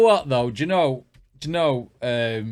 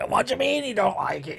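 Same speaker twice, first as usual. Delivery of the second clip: mock dramatic judge; 8 semitones higher, 5 dB quieter, faster than usual. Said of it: "dramatised the judges by going".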